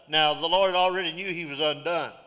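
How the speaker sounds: ordinary talk, nothing marked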